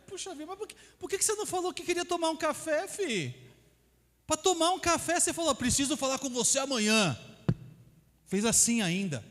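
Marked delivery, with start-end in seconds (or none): 0.1-7.1 exasperated mock outburst. To a colleague who only wanted coffee, high exasperated mock rant. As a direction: act out the exasperation at being scared for nothing.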